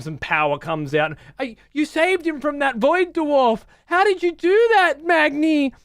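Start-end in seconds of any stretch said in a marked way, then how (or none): 1.4-5.7 much higher, hammed-up hero line; squeaky mock cutscene dialogue. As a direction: parody a gushing game character. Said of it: "cutscenes are just so painful to watch".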